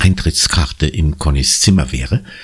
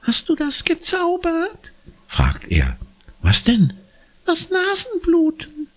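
put-on awed little boy. A narrator's reading. Impressed little brother asking Conny, awed. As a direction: wonder if she's magic. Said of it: "klingt schwer beeindruckt".

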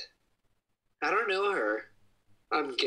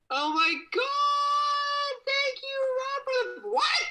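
very high chipmunk-pitched shout; squeaky filter voice; slower than usual. Pretend. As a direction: shriek it in a tiny high cartoon voice. Filter voice thanking followers.

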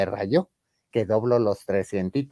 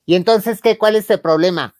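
mock angry challenge; 6 semitones higher, 7 dB louder than usual. Confronting the woman.

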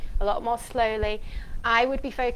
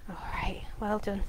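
soft praise; 3 semitones lower, 9 dB quieter than usual. Real, not acted. Therapist softly praising the boy during calming rocking, hushed.

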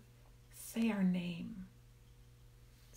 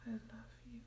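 heartfelt whisper; 23 dB quieter than usual. Shown as a demonstration. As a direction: whisper with all your feeling. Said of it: "Just say".

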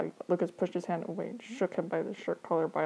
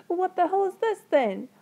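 much higher, louder indignant voice; angry demand; 12 semitones higher, 7 dB louder than usual. Acted. Brother pointing to the messy evidence, angry demand. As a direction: pitch it up in outraged accusation.

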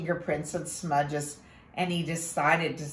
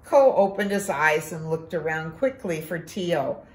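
usual speaking voice

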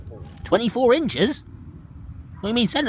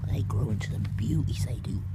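softer, breathy aside with no voice; whisper; 5 semitones lower, 8 dB quieter than usual. Real, not acted. To viewers about the onions growing into beauties, whispered. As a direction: let it drop to a secret whisper.

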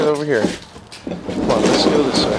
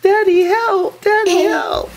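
put-on high squeaky child crying for daddy; little girl voice; 4 dB louder, slower than usual. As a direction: play the trapped little girl calling for help. Dad voices daughter trapped in basket.

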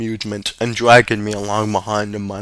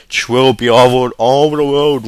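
a touch of a whimpering father; lightly voiced self-pity; whimpered. A narrator's reading. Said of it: "whimpered Father".

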